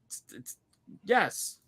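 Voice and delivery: high bursts around a breathless stammer; mock excitement. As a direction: play along with a kid's thrill. Playing along with child's excitement.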